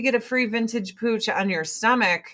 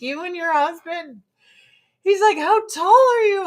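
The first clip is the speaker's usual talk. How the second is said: excited doctor; 10 semitones higher, 3 dB louder than usual. Retold or imitated.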